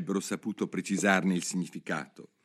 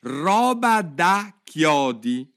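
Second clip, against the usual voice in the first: loud, high, word-by-word drawl; slow dictation; 8 semitones higher, 8 dB louder, much slower than usual. A narrator's reading. Jurors copying the words onto slates, slow deliberate reading. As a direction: stretch each word as if writing it down. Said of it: "tutti i giurati stavano trascrivendo".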